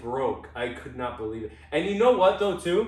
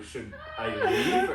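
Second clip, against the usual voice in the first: laughing; slower than usual. Retold or imitated. Retelling his panicked question.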